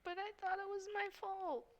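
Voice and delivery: high, soft little voice; small, wounded character voice; acted tearful hurt. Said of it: "she does just start to cry".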